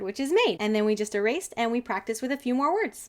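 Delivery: ordinary delivery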